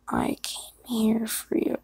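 near-whispered, slow read-through; just reading it. Real, not acted. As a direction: read it under your breath, slowly. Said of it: "I just read it".

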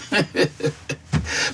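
soft, low chuckle. Acted. Old man ending a thought on dying alone, low chuckle. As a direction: chuckle quietly to yourself. Wry aside after "alone.

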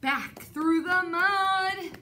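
high, loud, sung-out escape line; excited singsong. Acted. Reader sings out the escape to the children. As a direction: chant it like racing for home. Fleeing home through mud.

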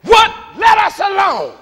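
loud, high shout of protest; full-voiced, high demand to be left alone; crying out. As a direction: cry out like the unclean spirit. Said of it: "and cried out, saying".

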